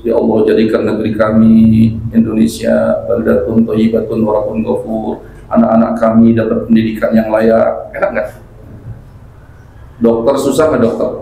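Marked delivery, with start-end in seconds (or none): 0.1-7.9 low, level-toned prayer recitation; recited prayer. Shown as a demonstration. Reciting to God the good prayer he made for the country, prayerful monotone. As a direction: recite a supplication on one low note. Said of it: "Doanya juga yang bagus-bagus".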